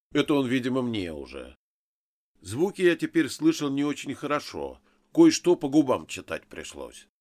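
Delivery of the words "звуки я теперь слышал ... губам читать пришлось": ordinary delivery, nothing marked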